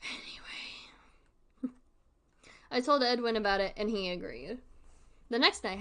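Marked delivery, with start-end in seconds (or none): 0.0-1.7 breathy whispered anyway; whispered aside. Acted. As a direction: drop to a sudden hush.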